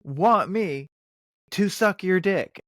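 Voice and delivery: high, put-on voice of the street woman; a hammed-up high offer; imitating the woman. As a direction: mimic her bold pitch. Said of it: "And she goes".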